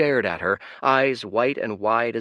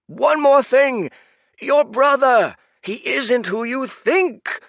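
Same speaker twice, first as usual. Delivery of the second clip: high, loud, slow desperate yell; desperate shout; 9 dB louder, slower than usual. Acted. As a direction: fling it after her as a last resort. Last desperate call after girl.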